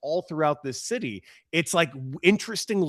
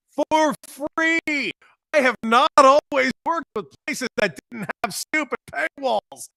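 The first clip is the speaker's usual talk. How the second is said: hyped radio promo; 10 semitones higher, 4 dB louder than usual. Acted.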